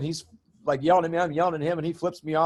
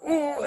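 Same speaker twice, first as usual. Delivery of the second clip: rising anger groan; 13 semitones higher than usual. Retold or imitated. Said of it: "I went".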